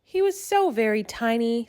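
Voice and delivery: ordinary delivery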